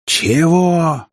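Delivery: high indignant exclamation. A narrator's reading. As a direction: challenge it, outraged. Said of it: "возмутился какой-то ветеран". (возмущённо)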